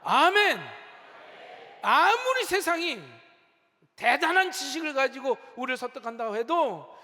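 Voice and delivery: loud, declarative pulpit voice; forceful preaching. Real, not acted. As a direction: proclaim it firmly to the congregation.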